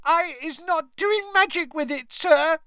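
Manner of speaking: very high squeaky elf voice, tearful; squealing house-elf. A narrator's reading. Terrified house-elf pleading with the ministry wizard, squealing. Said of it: "squealed Winky".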